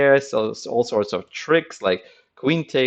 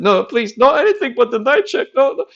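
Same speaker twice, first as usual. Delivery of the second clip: much higher, louder, fast desperate begging; mock desperate plea; 7 dB louder, faster than usual. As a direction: beg not to be checked. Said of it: "he said".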